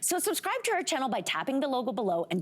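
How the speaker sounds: soft, close ad read inviting subscribers; promo outro read. Acted. Host to viewers at the end, soft promo read.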